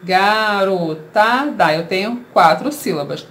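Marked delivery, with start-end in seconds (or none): 0.0-1.7 "Garotada" slowly in four even beats, ga, ro, ta, da; syllable by syllable. Shown as a demonstration. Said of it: "Vamos separar essa palavra em sílabas".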